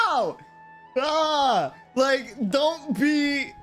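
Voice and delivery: high, pained plea; wailing, exaggerated dismay; exasperated anguish. Real, not acted. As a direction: cry out as if their shyness hurts you. Pained reaction to fans' shyness.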